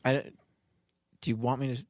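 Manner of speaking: ordinary delivery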